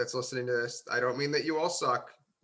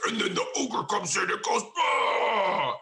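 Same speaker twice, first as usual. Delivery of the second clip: dramatic DM voice; 6 semitones higher, slower than usual. Retold or imitated. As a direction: play a theatrical game master who bellows the ogre's "Bah!". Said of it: "DMs that are gonna be like Matt Mercer".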